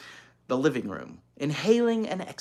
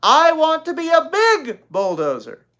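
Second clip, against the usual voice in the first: high, loud, sing-song bulldozer line; child showing the line; 12 dB louder than usual. Acted. Little girl showing her sister how to read it. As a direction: demonstrate the line with big gusto. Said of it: "You're supposed to say".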